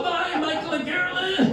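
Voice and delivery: very high, stretched-out singsong goodbye; mock farewell. Husband bids wife and son goodbye as plane falls, mock-cheerful. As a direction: wave them off in a gleeful high falsetto.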